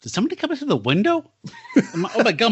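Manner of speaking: high, hurried mock alarm; dazed patient suddenly panicking, played for laughs; doped-up bewilderment. Co-host imagines doped-up hospital patient seeing intruder, high mock alarm. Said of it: "I was kinda highly doped up".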